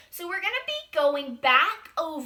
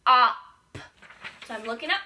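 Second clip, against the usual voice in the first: ordinary talk, nothing marked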